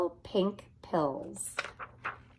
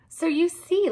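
laughing; 10 semitones higher, 3 dB louder than usual. Acted. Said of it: "laughed the cat".

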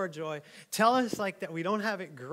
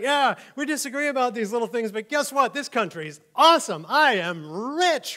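louder, smug campaign-speech tone; mock politician; 4 semitones higher, 9 dB louder than usual. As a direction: boast like a glad-handing politician. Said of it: "even the politicians".

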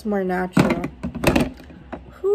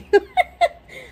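shrill burst of laughter; high laugh; 6 dB louder than usual. Real, not acted. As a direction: cackle at yourself, high and loud. Laughs at herself.

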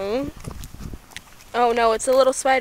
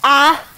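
pained yelp; 3 semitones higher, 7 dB louder than usual. Real, not acted. Teeth stuck, cries out.

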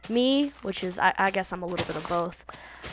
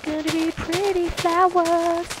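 high, slow cutesy voice; silly put-on voice; 9 semitones higher, slower than usual. Gamer cooing over flowers in a kiddie voice. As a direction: coo it like a little kid.

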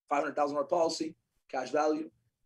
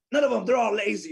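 louder, higher mock scorn; retold dismissal; 5 semitones higher, 6 dB louder than usual. Father talking about his own kids, mock dismissive.

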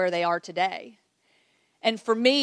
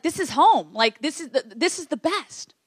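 higher, louder burst of delight; thrilled, retold reaction; delighted exclamation; 5 semitones higher, 3 dB louder than usual. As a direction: gush fondly about being back. Retold delighted reaction to old school.

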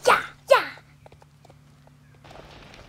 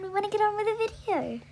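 normal talking voice

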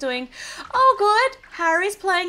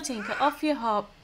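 normal talking voice

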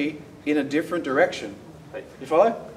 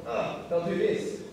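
ordinary delivery

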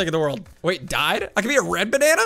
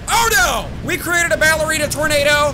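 much higher, loud panicked-gleeful shout; excited shout; 9 semitones higher, 6 dB louder than usual. Real, not acted. Gamer watches his ballerina tornado form, excited shout. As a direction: yell it out as chaos erupts.

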